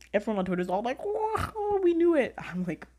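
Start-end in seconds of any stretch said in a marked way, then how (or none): 1.0-2.3 high, loud jeering imitation; mock Twitter crowd. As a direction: imitate a gloating online crowd. Mocking everyone on Twitter.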